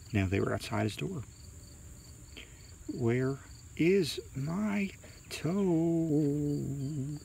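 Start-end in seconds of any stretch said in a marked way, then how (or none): 2.9-7.2 high, very slow haunting wail; groaning ghostly voice. A narrator's reading. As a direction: stretch every word into an eerie groan. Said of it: "the voice groaned".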